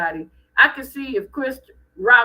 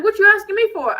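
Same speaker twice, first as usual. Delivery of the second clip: higher, louder imitated wife; indignant retort; 9 semitones higher, 6 dB louder, faster than usual. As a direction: fire back as the put-out wife. Wife's retort in retold exchange.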